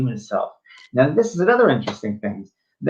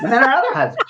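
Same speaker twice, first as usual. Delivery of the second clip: laughing; 12 semitones higher, 4 dB louder, faster than usual. Real, not acted. Third repeat, laughing, high.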